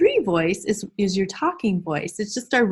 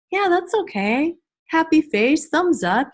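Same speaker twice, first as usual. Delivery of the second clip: high-pitched, encouraging teacher voice; cheerful approval; 8 semitones higher, 4 dB louder than usual. Pretend. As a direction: hand out approval to little ones. Acting out class discussion with children.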